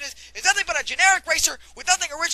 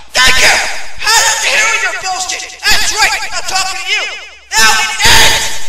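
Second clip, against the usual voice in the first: raging gamer; 9 dB louder than usual. Acted. Gamer yells at the game.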